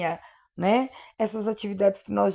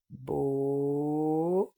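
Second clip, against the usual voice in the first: very slow, lower, each syllable held and separated; syllable by syllable, slowly; 5 semitones lower, 6 dB quieter, much slower than usual. Shown as a demonstration. Teacher showing pupils a syllable-joining game, very slow syllables. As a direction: draw out each syllable with long gaps. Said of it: "as sílabas faladas bem devagar".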